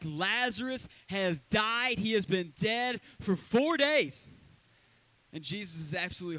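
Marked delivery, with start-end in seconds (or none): 0.0-4.3 slow, loud, higher lament; grieving sisters. Acted. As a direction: drag out the bad news in anguish. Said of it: "Mary and Martha are like".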